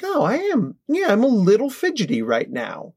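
a kid catching itself being fidgety, in a high mock-chirpy voice; child noticing feelings. Lightly voiced surprised child.

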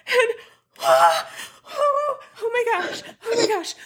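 gasping, tearful excitement; overwhelmed, can't breathe. Real, not acted. Fan shaking over the news, gasping with overwhelmed excitement. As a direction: gasp and gush as if short of air. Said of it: "and I can't breathe".

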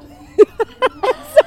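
real high loud giggle; startled high giggle at a joke. Real, not acted. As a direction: burst into a giggle. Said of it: "that elicited a giggle out of me".

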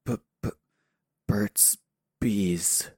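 weak stammer into the words; stuttered, broken-up mumble; halting mumble. Acted. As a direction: struggle out each word, with gaps. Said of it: "I, I mumble again".

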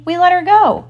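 high, breezy deckhand reply; offhand pirate answer, high and loud; casual. A narrator's reading. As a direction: report it lightly, pitched well up. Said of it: "Smee answered casually".

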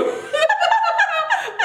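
high burst of laughter. Real, not acted. Laughing about her pain.

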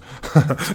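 short scoffing laugh; retold laugh. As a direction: laugh as if reliving an absurd offer. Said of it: "And then I started laughing".

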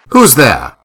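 ordinary delivery

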